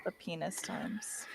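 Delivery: hushed unvoiced aside; whispering. Real, not acted. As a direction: whisper it like a secret joke. Whispered aside about past raid.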